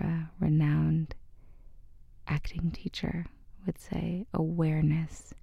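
soft, hushed breathwork guide with long pauses; guided meditation. Acted. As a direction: ease the listener gently into noticing their breath.

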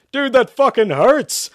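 very high, loud yelp; pained shout. A narrator's reading. Zach hurt by the holy water, shouting. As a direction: yell it in pain, drawn out. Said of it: "Zach shouted".

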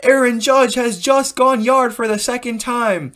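high drawn-out home run call; Vasgersian's yelling. Retold or imitated. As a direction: imitate an excited baseball announcer yelling the call. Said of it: "Vasgersian was yelling, like".

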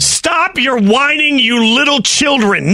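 drawn-out, exasperated scolding; scolding rant. Pretend. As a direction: tell off whiners, stretching each word. Host scolds complaining fans.

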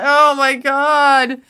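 high, loud, drawn-out "Oh my God"; slow, loud, exasperated outcry; overwhelmed exclamation. Retold or imitated. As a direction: stretch it out in near-desperate ecstasy. Recalling desperate arousal.

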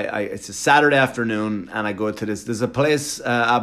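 ordinary talk

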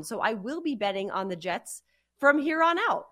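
usual speaking voice

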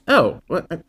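high, incredulous scoff; mock dismissive. Mocking an unimpressed viewer, incredulous scoff. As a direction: scoff like it's no big deal. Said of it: "it's like".

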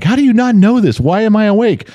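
high-pitched miffed imitation; mock-exasperated, high-voiced questioning; a little miffed. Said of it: "you were getting a little miffed".

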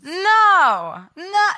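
usual speaking voice

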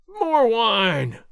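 loud barked call for wine; howling demand. Acted. Sergeant calling to the landlord, howling. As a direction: howl it across the tavern. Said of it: "Gonzales howled".

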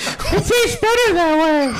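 very high, slow, creepy coaxing; drawn out creepy wheedle; creepy stranger voice. Pretend. Creepy stranger bit continues.

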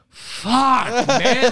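high, drawn-out mock dismay; exasperated groan. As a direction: imitate a listener who feels cheated. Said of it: "they just go".

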